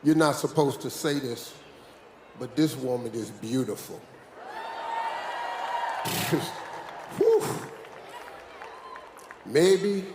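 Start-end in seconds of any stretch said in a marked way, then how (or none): 4.5-6.8 long, piercing falsetto whoop; high whooping howl. Real, not acted. Admiring the beautiful helper on stage, high whoop. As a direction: let out a wild high holler.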